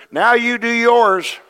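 loud, high and slow; God telling the church to do its part; emphatic command. Acted. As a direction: lay down the charge word by word.

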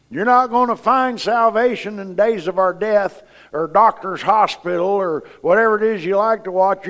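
forceful preaching. Real, not acted. Preacher urging the church to read the Word, forceful preaching. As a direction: drive each exhortation home to the congregation.

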